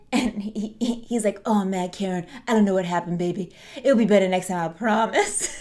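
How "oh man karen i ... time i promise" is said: lightly hammed-up New York accent. Glazer excusing himself to his lover, mock New York accent. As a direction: imitate a guy's goofy New York accent, laugh creeping in at the end. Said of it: "weird New York accent".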